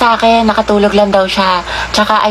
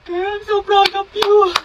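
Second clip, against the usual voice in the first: gushing proud bestie; 9 semitones higher, much slower than usual. Acted.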